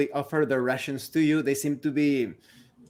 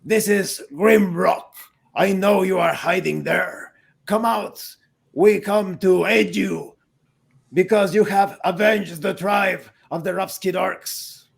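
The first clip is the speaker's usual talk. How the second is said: orc Grimrock; 4 semitones higher, 3 dB louder than usual. Acted. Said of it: "and says".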